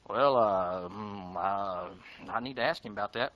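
low, unsure stalling; hesitant Peter. Retold or imitated. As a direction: hem and haw like caught out.

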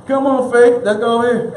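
high, loud call breaking into laughter; laughing coaxing. Acted. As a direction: urge them along while laughing. Acted coaxing, preacher breaking up laughing.

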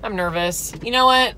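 ordinary talk

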